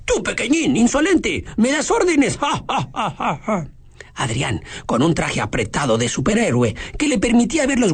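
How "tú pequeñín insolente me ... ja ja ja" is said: mocking bully laugh. Giovanni mocks small brother.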